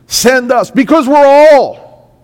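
preacher's rising call; impassioned plea. Acted. Same reply repeated, rising to shout.

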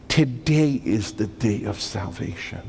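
ordinary talk

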